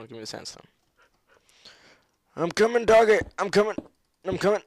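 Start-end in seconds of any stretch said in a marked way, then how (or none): 2.4-4.6 high, loud call to a pet. Real, not acted. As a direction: shout across to the dog. Calling out to his dog.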